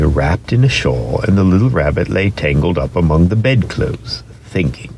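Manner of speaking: ordinary delivery, nothing marked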